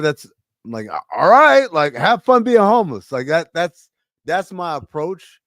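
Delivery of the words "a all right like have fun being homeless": loud, raised mock comeback; retorting. Himself answering a heckler in passing, mock retort. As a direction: fire back with a higher, louder voice.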